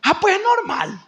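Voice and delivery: mocking dismissal. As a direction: shrug it off like a fool. Hermano sin sabiduría, cita burlona. (imitando con desdén, voz más aguda)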